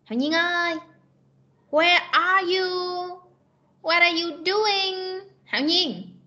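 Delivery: high, drawn-out call to a missing student. Real, not acted. Calls to a silent student.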